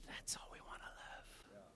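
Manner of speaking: hushed, slow whisper. Real, not acted. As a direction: breathe the line to the room. Closing thought to congregation.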